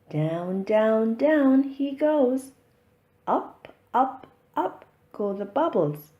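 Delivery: ordinary delivery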